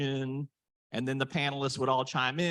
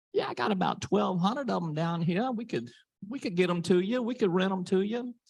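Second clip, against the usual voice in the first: put-on Cajun accent; 7 semitones higher than usual. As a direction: imitate the Cajun shop guy, easygoing. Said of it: "he has a thick Cajun accent".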